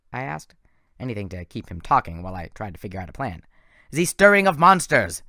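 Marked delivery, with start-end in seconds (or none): 3.9-5.2 loud, evil gloating voice; evil villain. A narrator's reading. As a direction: savour it with evil glee. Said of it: "Doctor Thorne smiled evilly".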